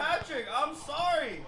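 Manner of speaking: loud, high apology yelled at the screen; shouted apology. Real, not acted. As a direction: yell your apology to the player.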